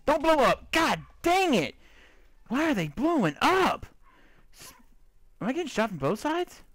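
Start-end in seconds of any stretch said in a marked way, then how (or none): 0.1-1.7 high, loud panicked yell; yelling as it blows up. Real, not acted. Gamer yelling at an exploding creeper, high and loud. 2.5-3.9 exasperated yell. Real, not acted. Continued panic.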